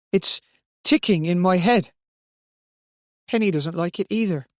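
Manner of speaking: put-on high, hesitant, frightened child's voice; small child upset and afraid; whimpering child. A narrator's reading. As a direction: whimper it in halting phrases.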